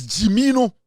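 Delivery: normal talking voice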